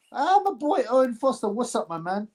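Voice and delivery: normal talking voice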